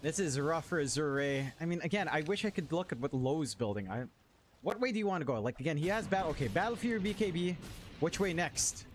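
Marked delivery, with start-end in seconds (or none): none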